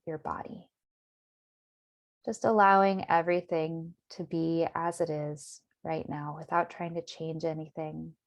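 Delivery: calm, measured meditation voice with long pauses; guided meditation. Acted. Meditation teacher settling listeners into their bodies, guided meditation. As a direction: guide them gently inward, unhurried.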